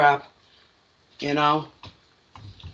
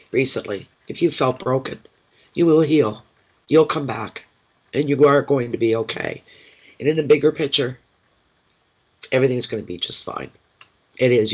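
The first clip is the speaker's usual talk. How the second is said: ordinary talk, nothing marked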